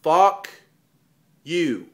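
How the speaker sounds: slow and deliberate, word by word; spelled out slowly for someone dim. Shown as a demonstration. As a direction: say it slowly with a long pause between words. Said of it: "Let me say it slow".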